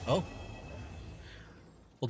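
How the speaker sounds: ordinary talk, nothing marked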